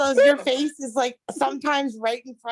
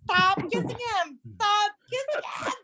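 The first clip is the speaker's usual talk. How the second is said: disgusted; 8 semitones higher than usual. Real, not acted. Disgusted protest at the kissing.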